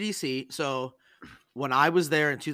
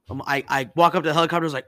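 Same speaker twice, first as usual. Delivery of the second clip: normal talking voice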